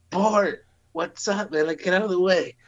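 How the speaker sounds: high-pitched imitation of a delighted captain; captain's hearty greeting. Captain spots his old Marine at a bar, imitated high greeting.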